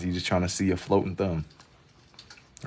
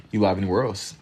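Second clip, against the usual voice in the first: ordinary talk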